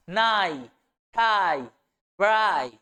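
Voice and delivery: नाई, थाई, ब्राई each ending on a stressed ai; isolating the ai rhyme. Shown as a demonstration. Teacher breaks the words down to their shared ai sound. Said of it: "आई sound जो है वो same".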